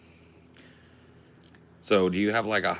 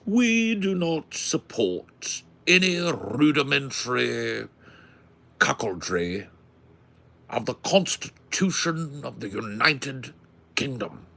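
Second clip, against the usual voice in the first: slow, hammed-up upper-class British; super high British; 5 semitones higher, 4 dB louder, slower than usual. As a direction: declaim in exaggerated high British. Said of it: "super high British".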